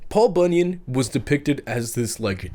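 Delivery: usual speaking voice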